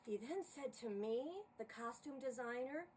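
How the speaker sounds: lively rhyming poem read aloud; poem recital. A narrator's reading. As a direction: narrate the verses with bounce and rhythm. Reads a holiday poem aloud.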